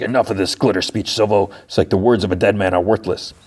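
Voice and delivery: ordinary talk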